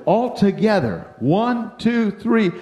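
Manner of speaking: high, loud, measured count; rallying count-in. Retold or imitated. Leader cues the boys to holler together, rallying count-in. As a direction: call out the count to cue everyone.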